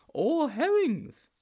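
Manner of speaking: high-pitched, naive sidekick; high storybook character voice; Sponge's character voice. A narrator's reading.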